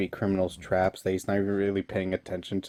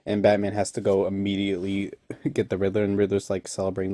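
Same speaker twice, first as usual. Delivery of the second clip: ordinary talk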